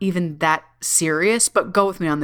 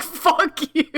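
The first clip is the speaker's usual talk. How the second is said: playful retort; 9 semitones higher than usual. Retold or imitated. Playful comeback to teasing dad.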